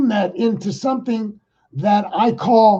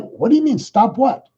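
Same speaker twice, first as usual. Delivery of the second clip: incredulous retort; 6 semitones higher than usual. Retold or imitated. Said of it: "And I'm like".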